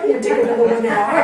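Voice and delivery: laughing through the words. Real, not acted. Teacher laughing at the class over her sarcastic joke. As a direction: crack up at the joke.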